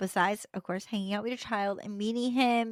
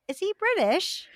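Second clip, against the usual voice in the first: curious stranger; 9 semitones higher than usual. Retold or imitated.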